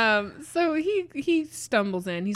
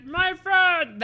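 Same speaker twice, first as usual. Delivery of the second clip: high loud pleading monster cry; squeaky monster yell; 10 semitones higher, 7 dB louder than usual. Shown as a demonstration. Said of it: "That's the voice".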